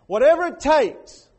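loud, high burst of pleading; desperate outburst. Retold or imitated. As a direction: suddenly raise it, desperate. Rich man's desperate plea.

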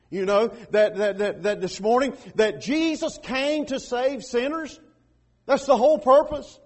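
loud, high preacher's proclamation; full-force preaching. Real, not acted. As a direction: proclaim it with rising force to the congregation.

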